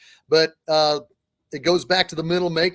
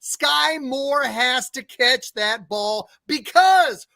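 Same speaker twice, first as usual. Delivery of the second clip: loud, high, building recap; excited play-by-play; 5 semitones higher, 3 dB louder than usual. Real, not acted. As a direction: call it like a live play.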